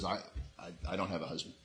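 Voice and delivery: very soft, low defensive mumble. Acted. The woman answers Jesus about her husband, quietly defensive. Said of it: "she immediately got defensive and says".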